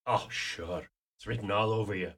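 ordinary talk, nothing marked

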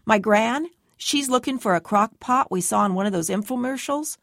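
"My Gran?" and "infomercials?" lifted at the end like questions. A narrator's reading. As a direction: make a statement sound like a question, rising on "Gran" and "infomercials". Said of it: "I say it like a question".

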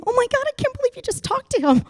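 high, fast giddy squeal; the starstruck half losing it; fast high excited whisper-thought; inner freakout. Retold or imitated. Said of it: "the other half of me is going".

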